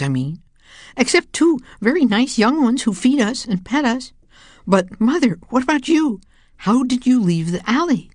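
high, eager questions; eager young cat. A narrator's reading. Grown children question their mother, high and eager.

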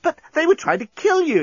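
very high-pitched protest; exclaiming. A narrator's reading. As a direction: exclaim in disbelief, voice shooting up. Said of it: "exclaimed Nettie".

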